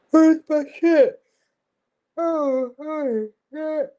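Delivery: sudden high, loud squeal of alarm; high panicked yelp. Real, not acted. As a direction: shriek it out, suddenly frantic. Frantic search for lost item.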